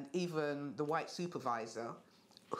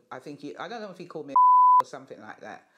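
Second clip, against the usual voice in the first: laughing it off; 16 dB louder, faster than usual. Real, not acted. Awkward laugh recalling racist slur.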